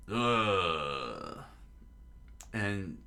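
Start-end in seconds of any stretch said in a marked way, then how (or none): 0.1-1.4 long, falling groan; acted groan of exertion; effortful groan. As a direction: strain out a drawn-out ugh. Zolo struggles up the oily hill.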